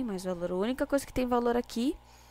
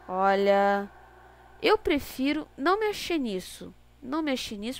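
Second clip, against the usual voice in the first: ordinary talk, nothing marked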